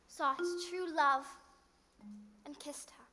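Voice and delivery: gentle bedtime story reading; storytelling. Acted. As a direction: read it like a fairy tale to a child.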